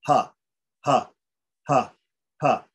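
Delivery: breathy ha, airy, no voice behind it; aspirate demo. Shown as a demonstration. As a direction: push out air on each ha. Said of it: "It's just letting out air".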